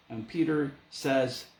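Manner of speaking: ordinary delivery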